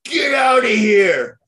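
high, loud, slow yell; furious yell. Retold or imitated. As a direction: bellow it, ordering him out. Drunk customer yells at cop.

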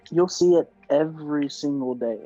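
ordinary talk, nothing marked

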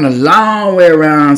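"long" held out slow and loud; emphatic "long", dragged through to "around"; drawn-out LONG. Real, not acted. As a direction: stretch "long" to act out the detour. Echoes the relative's drawn-out phrase.

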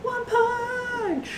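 very high, drawn-out battle call; stretched-out squeal of an attack name; playful attack cry. Pretend. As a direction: call the finishing move in falsetto.

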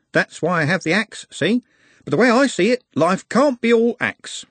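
jovial driver musing; worldly bus driver. A narrator's reading. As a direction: spin a yarn about the night bus. Driver on the omnibus.

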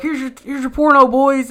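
high, hammed-up cheery offer; mock cheery handout. Retelling how an adult offered the boys magazines, in a high mock cheer. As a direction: play it up like a grinning relative handing over a gift. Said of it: "they're like".

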